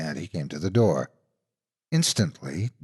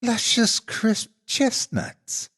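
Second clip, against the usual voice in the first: much higher, very slow sugary monster; sly lie; much slower than usual. A narrator's reading. Monster tempting the boy, slow sugary high voice. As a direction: lure the boy with a sweet lie. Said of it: "Dagger Claws lied".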